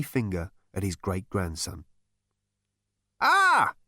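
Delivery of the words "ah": put-on very high, sharp old-man cry; snapped exclamation. A narrator's reading. Old ancestor spots his great-grandson, snapping high. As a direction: snap it in a high elderly voice. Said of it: "he snapped".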